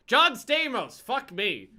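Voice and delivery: sudden loud shout of recognition; a name suddenly remembered, shouted; excited shout. Real, not acted.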